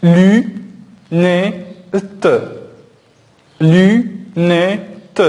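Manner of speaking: "lunette" said as lu-net-te, drawn out; split into syllables. Shown as a demonstration. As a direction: cut "lunette" into three separate syllables. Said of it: "on va dire". (dire "lunette" en trois syllabes détachées)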